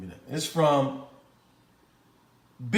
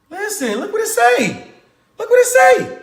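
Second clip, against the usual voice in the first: excited; 12 semitones higher, 4 dB louder than usual. Real, not acted. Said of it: "Y'all know I get excited".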